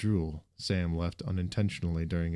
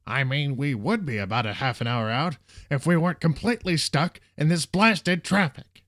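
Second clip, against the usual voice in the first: loud, high tirade, slowing at the end; returning anger; 8 semitones higher, 7 dB louder than usual. A narrator's reading. Bus driver stuck in traffic, his anger returning. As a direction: build from exasperation to a full angry shout. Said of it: "made his anger return".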